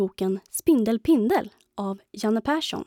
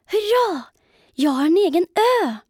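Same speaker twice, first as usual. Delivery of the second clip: high, bright, quick little-spider cheer; excited cheer; 8 semitones higher, 6 dB louder than usual. A narrator's reading. Spider Pindel delighted by his island, high excited cheer. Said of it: "och tänkte för sig själv".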